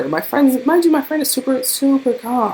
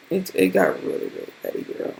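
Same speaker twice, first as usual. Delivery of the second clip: much lower, near-silent whisper; hushed, lower whisper; whispered lament; 11 semitones lower, 7 dB quieter than usual. Real, not acted. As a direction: drop to a breathy whisper. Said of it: "repeated lament".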